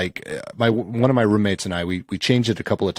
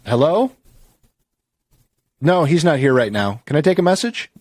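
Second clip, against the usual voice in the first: fake phone answer; 6 semitones higher, 3 dB louder than usual. Typical fake answering message.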